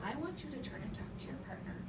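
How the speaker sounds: ordinary delivery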